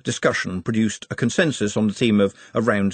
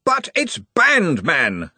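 insisting; 8 semitones higher, 3 dB louder, much slower than usual. A narrator's reading. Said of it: "the Arch-Chancellor insisted".

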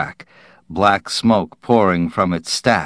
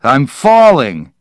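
higher, louder startled yell; alarmed cry; 5 semitones higher, 7 dB louder than usual. Acted. Vecchi stepping onto the field, crying out loud. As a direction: cry out as if toppling. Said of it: "He swayed wildly".

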